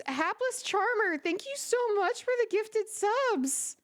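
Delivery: high, breathless delighted thanks; overjoyed squeal. Real, not acted. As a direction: squeal with stunned gratitude. Gifted subs, streamer overwhelmed.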